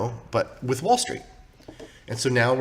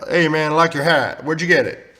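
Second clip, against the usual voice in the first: loud, raised pretend fan voice; mock commenter call-out; 3 semitones higher, 7 dB louder than usual. Dictating a joke comment to viewers, mock call-out. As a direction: shout out a friendly comment in mock fashion.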